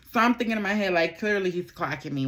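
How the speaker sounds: ordinary delivery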